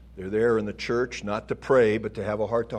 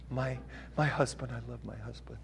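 soft, much quieter, breathy-sounding swoon of a doting bride; adoring wife; 9 dB quieter than usual. Acted. Preacher mimicking a responsive bride, softly swooning. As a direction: coo it like a starry-eyed wife.

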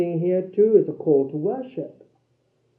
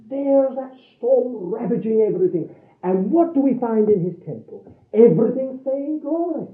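raised-pitch, forceful sermon climax; impassioned preaching; 4 semitones higher, 7 dB louder than usual. Real, not acted. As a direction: preach it with rising fervour, driving onward.

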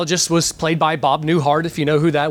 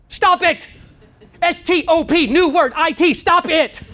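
emphatic; 8 semitones higher than usual. Acted. Psychologist spells out the cure.

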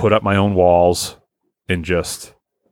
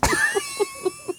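high shrieking laugh; 9 dB quieter than usual. Real, not acted. Laughing at his own bit.

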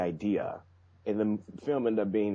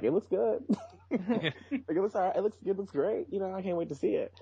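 higher, lightly mocking forced-cheerful voice; mock Lucas reaction; 10 semitones higher than usual. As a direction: play an unconvinced director saying it's great. Voicing Lucas reacting to trailer.

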